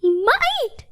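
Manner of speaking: high, sharp yelp. A narrator's reading. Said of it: "yelped the squirrel".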